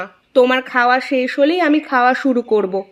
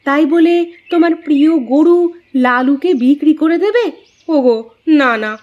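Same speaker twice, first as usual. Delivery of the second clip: dismayed surprise; 6 semitones higher, 3 dB louder than usual. Acted. Wife hears cow will be sold.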